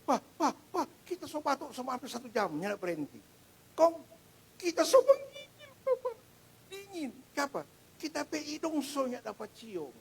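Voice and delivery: high, tearful voice of a frightened daughter on the phone; imitating panicked daughter, high and tearful; mimicking distressed daughter. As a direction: plead like a sick scared child. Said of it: "anak Anda berteriak seperti itu".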